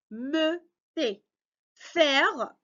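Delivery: "fermeté" slow, split into fer-me-té; syllable by syllable. Shown as a demonstration. Teacher cutting a word for learners, slow separate syllables. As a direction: leave a gap after each syllable of "fermeté". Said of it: "il faut couper le mot par syllabe".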